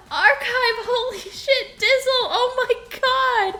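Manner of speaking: high, loud, thrilled thank-yous; excited thanks. Real, not acted. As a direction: shout your thanks, overjoyed. Hype train gifts, excited squeals.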